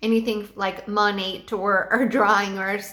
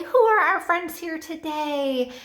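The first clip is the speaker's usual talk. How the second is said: higher, slow, inviting question; warm and welcoming; 6 semitones higher, slower than usual. Shown as a demonstration. Teacher greeting students at class start, warm and welcoming. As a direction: welcome the children in gently. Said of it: "make it warm and welcoming".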